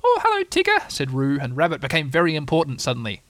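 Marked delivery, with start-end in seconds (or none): 0.0-0.9 very high, loud squeaky greeting; piping little animal voice; excited little Roo. A narrator's reading. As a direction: greet him in thrilled falsetto. Roo greets Tigger in story.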